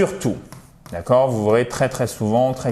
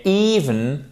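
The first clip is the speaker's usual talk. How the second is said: "Even" stressed up front on a long i; stress on first syllable; 3 semitones higher than usual. Shown as a demonstration. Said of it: "accent tonique sur la première syllabe".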